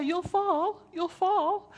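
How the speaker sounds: mock nagging parent. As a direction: play the worried parent at the tree. Mock worried parent warning.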